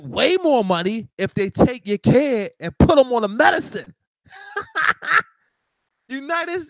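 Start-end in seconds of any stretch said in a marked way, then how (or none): 4.5-5.2 short high burst of laughter. Real, not acted. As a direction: laugh at the absurdity. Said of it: "the reason why I'm laughing".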